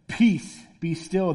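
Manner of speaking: firm, understated divine command; mildly booming command. Acted. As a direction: say it as the expected commanding voice, lightly played. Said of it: "just booming voice that, like, echoes".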